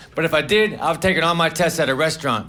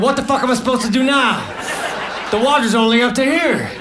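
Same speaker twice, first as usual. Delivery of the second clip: higher-pitched mock exasperation; exasperated pool actors; 5 semitones higher than usual. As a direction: whine like an actor stuck in a shallow pool. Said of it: "the actors would have said".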